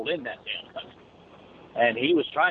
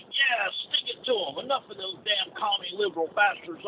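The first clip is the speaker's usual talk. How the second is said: mock cheering loaders; 8 semitones higher than usual.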